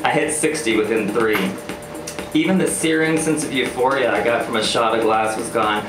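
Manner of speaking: usual speaking voice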